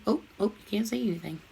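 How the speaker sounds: normal talking voice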